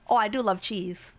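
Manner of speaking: ordinary delivery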